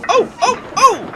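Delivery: very high, very loud wailing oh; shrill protesting trucks. Acted. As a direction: howl like squealing trucks.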